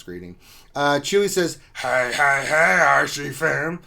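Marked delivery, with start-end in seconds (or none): 1.8-3.8 higher, louder wrestling announcer bellow, drawn out very slow; booming pro wrestling announcer; WWE announcer. Retold or imitated. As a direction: stretch out happy hump day like a ring announcer hyping the crowd. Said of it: "Said that like the W W E announcer".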